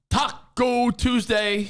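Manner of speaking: high, long drawn-out catchphrase; catchphrase call. Retold or imitated. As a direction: stretch it out high like a goofy announcement. Mimicking a viral taco night catchphrase.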